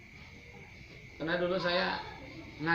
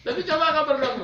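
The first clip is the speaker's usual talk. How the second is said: loud, high impassioned preaching; fiery preaching; 11 semitones higher, 9 dB louder than usual. Acted. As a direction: drive it home to the congregation. Preaching at full force. (khotbah berapi-api, keras dan tinggi)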